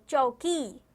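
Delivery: चौकी very slow with long vowels; slow teacher demonstration of चौकी; sounded out. Shown as a demonstration. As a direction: draw out both syllables of चौकी. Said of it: "सुनिए".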